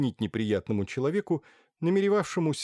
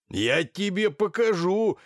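angry shout; 9 semitones higher, 5 dB louder, slower than usual. Acted. Lackey shouting from the stairs.

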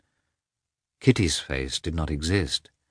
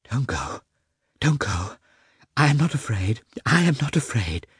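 higher, anxious pleading; hurried plea; 5 semitones higher than usual. A narrator's reading. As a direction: plead in short urgent bursts, pitch climbing. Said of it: "she said rapidly".

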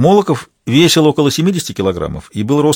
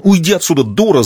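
higher, raised angry yell; yelling at wife; 6 semitones higher, 3 dB louder than usual. A narrator's reading. Husband yelling at his wife, raised angry yell. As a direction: bark her away in fury. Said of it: "заорал он на жену".

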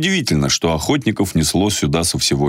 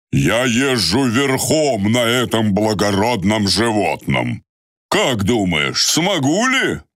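put-on proud old man voice; 6 semitones higher, slower than usual.

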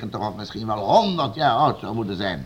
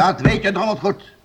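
higher, louder snapping retort; angry outburst; 9 semitones higher, 6 dB louder than usual. Acted. Lord snapping at lying servant, angry and loud. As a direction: bite back at the lie.

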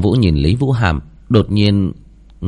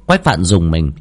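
ordinary delivery

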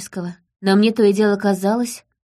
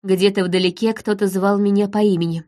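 plain reading voice

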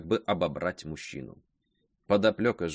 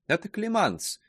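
put-on young woman cutting in, much higher; interrupting girl; 11 semitones higher than usual. A narrator's reading. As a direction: jump in over the old maid, pitched up. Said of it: "перебила её Сарьетта".

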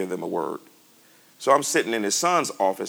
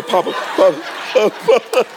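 laughing imitation; 11 semitones higher, 8 dB louder than usual. Man crawls begging to Papa.

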